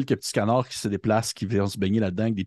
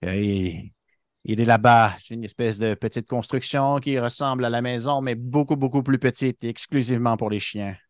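lightly voiced duck pointing out; a hint of a helpful duck. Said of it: "puis il fait".

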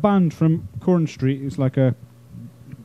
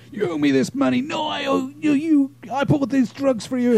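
mock junkie quarrel; 7 semitones higher than usual. Acted street quarrel of addicts.

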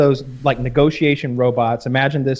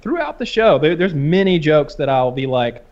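ordinary talk, nothing marked

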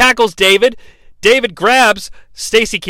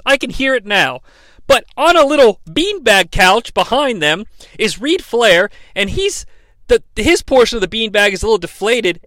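ordinary talk, nothing marked

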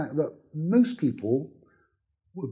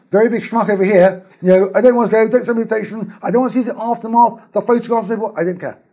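raised, emphatic refusal; higher and louder, brushing it off; defiant, loud; 7 semitones higher, 7 dB louder than usual. Pretend.

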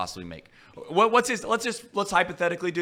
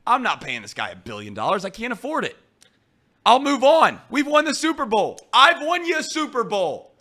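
imitating team owner; 6 semitones higher, 4 dB louder than usual. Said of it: "Mike Brown would be like".

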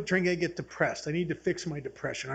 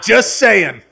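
much louder, amused aside to the crowd; big, loud comic button; loud punchline tag; 3 semitones higher, 15 dB louder than usual. Real, not acted. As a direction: toss it out loud over the laughter. Calls punchline out over audience laughter.

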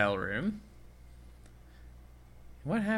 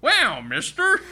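high, loud, wide-eyed; high loud mock wonder; hammed-up gee-whiz kid; 12 semitones higher, 5 dB louder, slower than usual. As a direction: gush it like an old-timey boy. Spoof rental clerk.

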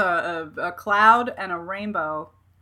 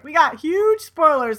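drawn-out, louder mock hype; hyped announcement; 6 semitones higher, 5 dB louder, slower than usual. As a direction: imitate an excited headline, higher and slower. Quoting a hyped website announcement.

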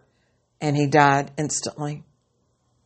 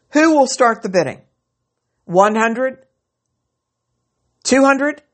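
auctioneer; 8 semitones higher, 4 dB louder than usual. Acted. Auctioneer calling for bids.

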